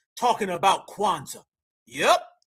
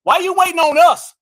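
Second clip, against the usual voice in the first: much louder and higher, demanding an answer; exasperated shout; 6 semitones higher, 9 dB louder than usual. Real, not acted. Ranting at critics who won't build up Africa, exasperated shout. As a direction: throw the question at them like an accusation.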